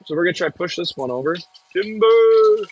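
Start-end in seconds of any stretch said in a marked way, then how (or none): 1.7-2.7 long high call of timber as the tree goes. Real, not acted. Logger calling out to the stream as the tree falls, loud call. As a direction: yell it out to warn everyone.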